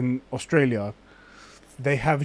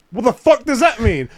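higher, louder mock bafflement; baffled outburst; 7 semitones higher, 5 dB louder than usual. Retelling confusion over slang, baffled. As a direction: pitch it up in disbelief.

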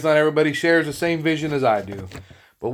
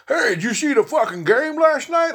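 much higher, mock bro voice; typical guy voice; 11 semitones higher than usual. Imitating a typical man asking about the game. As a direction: ham up the sports guy. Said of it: "typical man".

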